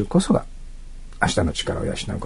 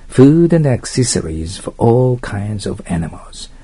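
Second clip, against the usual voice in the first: slow model reading; much slower than usual. Shown as a demonstration. Teacher reads ad slowly.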